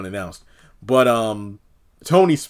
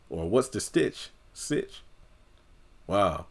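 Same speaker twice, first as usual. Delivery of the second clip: ordinary talk, nothing marked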